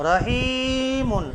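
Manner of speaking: রহিমুন said slowly with রহি held; long drawn rahi. Shown as a demonstration. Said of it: "রহিটা টান দিতে হবে". (রহি টেনে রহিমুন)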